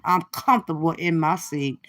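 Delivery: ordinary talk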